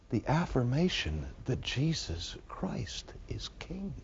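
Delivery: slow awed whisper. Real, not acted. Preacher tells listeners Christ is king in a slow whisper. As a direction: let it fall to a whisper.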